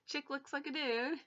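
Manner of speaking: ordinary talk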